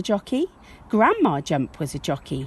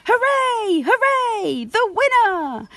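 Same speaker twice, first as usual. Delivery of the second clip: high, loud crowd hooray; crowd cheering; 5 dB louder, slower than usual. Acted. Crowd cheers the race start, high loud hooray. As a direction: cheer like a whole stadium. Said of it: "The crowd cheered".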